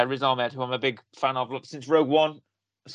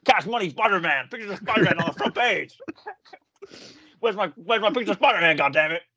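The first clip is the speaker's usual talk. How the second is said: higher, barking newspaper boss; J K Simmons impression; 4 semitones higher than usual. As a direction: yell like an angry editor. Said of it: "as I do that, I, like, mime like I've got a cigar in my mouth".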